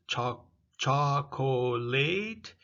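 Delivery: "chocolate" scandito piano, tutte le sillabe; slow careful chocolate. Shown as a demonstration. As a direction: stretch "chocolate" out syllable by syllable. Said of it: "Remember, we had".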